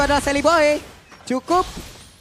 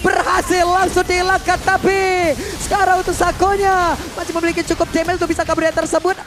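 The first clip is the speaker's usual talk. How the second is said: esports caster; 4 semitones higher than usual. Real, not acted. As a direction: call the fight with urgency. Live play-by-play caster.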